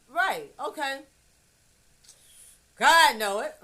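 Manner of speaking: ordinary talk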